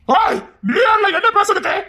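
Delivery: high-pitched, confrontational yell; angry shouting. Acted. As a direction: challenge their right to speak, voice flung high. Family quarrel, challenging the speaker.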